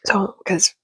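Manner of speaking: hushed morning whisper so nobody wakes; soft whispered chatter. Real, not acted. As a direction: whisper it, the house is still asleep. Whispers while everyone sleeps.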